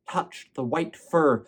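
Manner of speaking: plain reading voice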